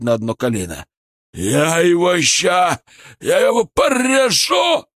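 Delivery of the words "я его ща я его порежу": loud, high, drawn-out roar; furious threat. A narrator's reading. Бычок gets up to cut the narrator, furious threat. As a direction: roar the threat, slow and straining.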